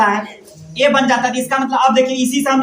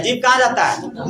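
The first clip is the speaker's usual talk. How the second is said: ordinary talk, nothing marked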